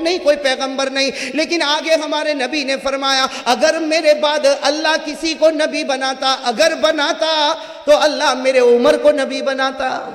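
high, loud, slow preaching; impassioned preacher's climb; fiery sermon. Acted. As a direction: drive it home to the crowd. Preacher at full force.

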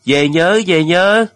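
high, loud shout towards a departing ship; calling out farewell. A narrator's reading. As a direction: call it across the water. Crowd calls to departing ship.

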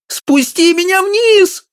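very high, slow squealing plea; squeak. A narrator's reading. Wife stuck on the pedestal, squealing. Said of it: "пищала она".

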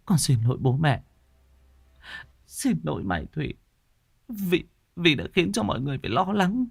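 put-on a weak girl apologizing near tears; tearful apology. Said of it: "Lan Anh mếu máo". (mếu máo xin lỗi, giọng nhỏ và cao)

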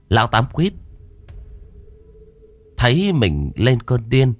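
ordinary delivery

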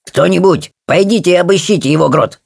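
high-pitched command; ordering. Acted. Timmins sends kobolds to search the grotto, high commanding tone. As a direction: give the order to the crowd. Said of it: "велел Тимминс".

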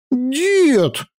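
high indignant shriek; shrill outraged cry; indignant squeal. A narrator's reading. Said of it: "возмущённо заверещала ослушница".

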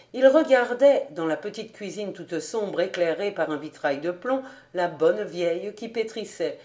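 ordinary delivery, nothing marked

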